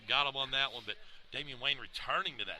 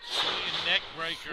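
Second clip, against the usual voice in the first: excited commentary; 4 semitones higher, 8 dB louder than usual. Real, not acted. Calling the move.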